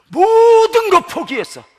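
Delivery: stretched, high and loud emphasis; impassioned cry. Real, not acted. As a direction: draw the first word out into a shout. 설교 중 포기를 강하게 강조. (길게 늘여 외치는 강조)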